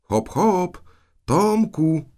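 loud, high-pitched holler; hallooing at full voice; booming call. A narrator's reading. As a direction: shout across the garden for the boy. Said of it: "Gromkim głosem zawołała".

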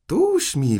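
high, loud pounce on the culprit; triumphant catch. Acted. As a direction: exclaim as you nab him. Aunt pounces on the caught boy.

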